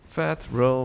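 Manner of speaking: normal talking voice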